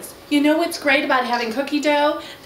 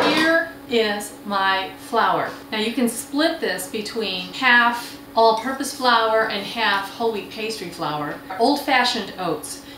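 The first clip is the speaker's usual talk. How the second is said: usual speaking voice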